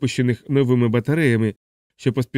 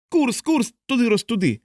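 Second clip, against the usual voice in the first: much higher, thin anguished cry; McWatt's thin tortured yell; 11 semitones higher than usual. A narrator's reading. Pilot over the intercom, a thin anguished yell. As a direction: strain up into a thin suffering wail. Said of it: "тонким страдальницьким голосом зарепетував".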